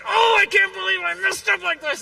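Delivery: fuming. Retold or imitated. Man assembling a grill, furious at skipping a step. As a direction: blow up at yourself over the mistake. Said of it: "I am fuming inside".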